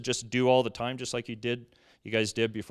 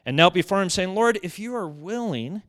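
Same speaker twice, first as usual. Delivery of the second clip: loud, emphatic preacher's reading of the passage; scripture reading; 6 dB louder than usual. Acted. Preacher reading scripture loudly to the congregation. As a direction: read the gospel out with force.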